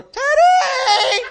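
high, loud shout with a laugh; crying out. Retold or imitated. Driving at dawn with top down, high loud yell. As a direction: bellow the dog's name from the car. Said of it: "I was crying out for Teddy".

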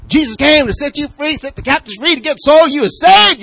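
high, loud preaching to a pet; hammed-up street preacher, high and loud; demo preaching. Shown as a demonstration. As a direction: preach it out to your dog. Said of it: "you start preaching".

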